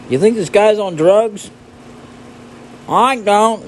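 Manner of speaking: high put-on bystander voice; gossiping onlooker. Onlooker voices discuss the player.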